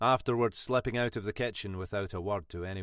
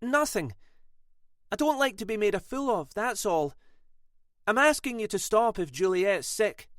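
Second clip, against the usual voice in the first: sharp, high snap; snapping; 11 semitones higher, 4 dB louder than usual. A narrator's reading. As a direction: snap back, offended. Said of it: "Sylvia snapped".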